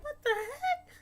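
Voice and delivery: very high, squeaky shocked cry; stunned at the sight; shocked disbelief. Retold or imitated. Shock at pills flying out.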